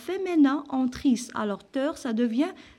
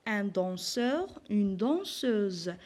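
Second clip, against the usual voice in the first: slow model words; 2 semitones lower, 3 dB quieter, much slower than usual. Shown as a demonstration. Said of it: "teacher models example words".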